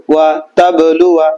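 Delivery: তাবলুয়া read slowly with the alif silent; slow, deliberate recitation of তাবলুয়া; Quranic reading demo. Shown as a demonstration. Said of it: "আমাদের পড়তে হবে কিভাবে?". (ধীরে তাবলুয়া, আলিফ ছাড়া)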